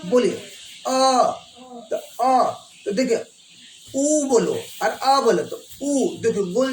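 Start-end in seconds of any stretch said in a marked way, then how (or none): 0.3-1.3 अ drawn out slowly; slow vowel demo. Shown as a demonstration. Teacher asks class to feel अ, slow. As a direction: stretch अ so they feel the mouth. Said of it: "जैसे आप feel कीजिए, बोलिए अ".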